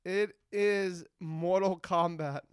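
normal talking voice

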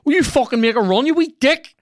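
high, loud yell at the player; frustrated yell. Real, not acted. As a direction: scream at him to run. Yelling at his player.

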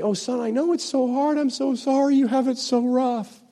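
very high, cooing pity; exaggerated coddling of a poor little son; mock sympathy. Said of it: "he didn't say".

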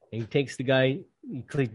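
ordinary talk, nothing marked

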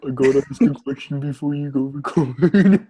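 playful impression of a mom calling out, breaking into laughter; a hint of a tipsy mom, laughing; imitating a mom. Voices the mom, tipsy on couch.

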